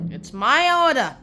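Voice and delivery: high, loud, drawn-out mock order; imperious command. Young master ordering the gardener to obey, imperious command. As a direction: pull rank with hammed-up authority.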